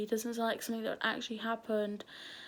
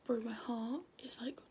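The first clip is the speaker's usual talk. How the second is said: hushed and rattled; nervous whisper; 6 dB quieter than usual. Real, not acted. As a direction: whisper it, heart racing. Scared whisper at 3 AM.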